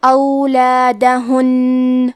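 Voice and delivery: اولادہن drawn out slowly with nasal غنہ on the ن; slow tajweed recitation. Shown as a demonstration. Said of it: "tajweed demo of the word".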